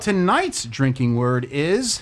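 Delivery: slow, drawn-out reveal; slow dramatic reveal. Acted. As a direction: stretch it out for suspense. Announcing the drinking word.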